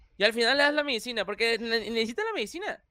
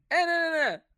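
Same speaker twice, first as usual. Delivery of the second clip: mock retake; 7 semitones higher, 4 dB louder than usual. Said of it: "Y otra vez".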